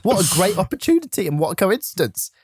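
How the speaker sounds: high, overly innocent pretend surprise; fake casual eagerness. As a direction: play it sweetly too convenient. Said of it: "I'd be like".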